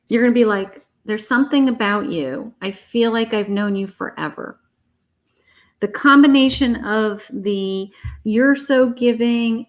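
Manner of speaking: ordinary talk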